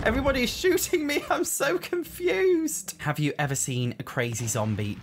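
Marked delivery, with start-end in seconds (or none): 0.0-2.9 high-pitched, bewildered wail; flustered outcry. Real, not acted. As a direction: wail it, drawing out the last words. Shot at by everyone in game.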